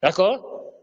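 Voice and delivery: usual speaking voice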